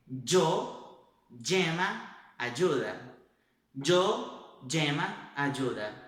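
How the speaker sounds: llama as djema, ayuda with a j sound; y and ll as a D and J blended. Shown as a demonstration. Teacher models the dj sound for y and ll. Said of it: "E se fosse em outros países".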